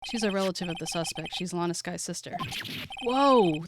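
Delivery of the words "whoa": startled whoa. Acted. Shocked at the sister reveal.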